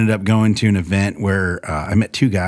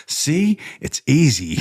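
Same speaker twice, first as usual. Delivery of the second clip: higher, sly ribbing; teasing told-you-so; 7 semitones higher, slower than usual. Retold or imitated. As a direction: needle him with a playful told-you-so. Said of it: "looking at me and goes".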